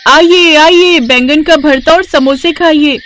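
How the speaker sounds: loud, high street-vendor call; high hawker's cry, selling samosas and bharta; calling out customers. Acted. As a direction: shout it out to draw buyers at the market.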